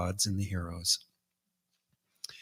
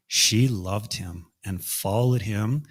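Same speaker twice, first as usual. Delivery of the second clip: usual speaking voice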